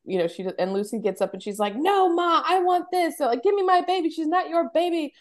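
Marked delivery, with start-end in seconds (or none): 1.8-5.1 high, exasperated retelling of a protest; irritated daughter-in-law. Retold or imitated. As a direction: act out an overheated, fed-up young mother. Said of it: "she's really getting irritated".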